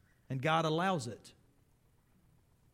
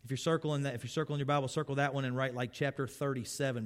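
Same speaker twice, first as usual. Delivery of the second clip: ordinary delivery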